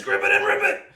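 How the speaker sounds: high, very loud team-name shout; sudden burst of yelling. Shown as a demonstration. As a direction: holler it in all capitals. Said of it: "do I need to yell it".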